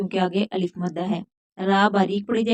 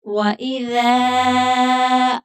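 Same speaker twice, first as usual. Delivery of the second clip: slow melodic tilawat chant; measured Quranic chanting; Quran recitation; 3 semitones higher, 5 dB louder, much slower than usual. Acted.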